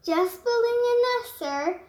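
busy little bird, offhand; chirpy bird. Acted. Bird answers the moose while nesting, chirpy. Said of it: "the zinazoo said".

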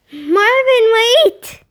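loud, high call after him; boy yelling after his running pig; shout. A narrator's reading. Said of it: "Nick shouted".

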